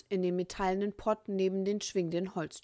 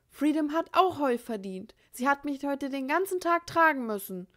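put-on higher boyish voice, slightly unsteady; not quite steady; 9 semitones higher, 5 dB louder than usual. A narrator's reading. Andy defending his unicorn, slightly shaky voice. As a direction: try to sound braver than you feel. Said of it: "Stimme nicht ganz so fest".

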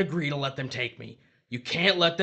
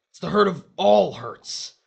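much louder, higher anguish; anguished cry; 5 semitones higher, 9 dB louder than usual. Acted. Dead husband telling wife of his pain, anguished.

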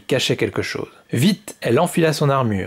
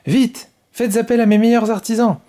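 high, louder eager order, last words slowed; excited little king, pitched up; eager exclamation; 10 semitones higher, 4 dB louder than usual. Acted. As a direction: call for the artisans full of excitement. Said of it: "s'exclama le petit roi".

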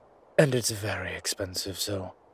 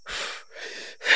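weary exhale. Acted. Exhausted breath while suffocating.

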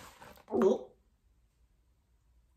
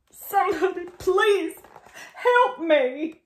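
pleading for help; 8 semitones higher, slower than usual. Acted. Crocodile calls for help.

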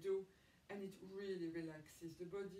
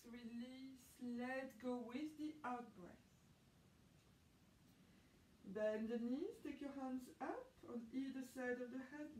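calm, even yoga teacher voice; guided breathing instruction; 4 semitones higher than usual. Acted. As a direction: talk students gently through each breath.

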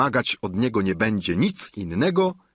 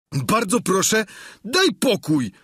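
high, pleading peasant squire voice; a squire begging his master to leave him be; Sancho, the squire; 10 semitones higher than usual. A narrator's reading. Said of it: "odrzekł Sancho". (wysoki, błagalny głos giermka)

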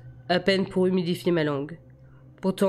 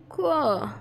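high, whiny falling reply; grumpy; 10 semitones higher than usual. A narrator's reading. Bella, half asleep and wanting sleep, grumpy reply. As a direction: answer grumpily in a much higher voice. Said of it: "Elle était ronchonne à présent".

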